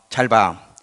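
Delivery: ordinary talk, nothing marked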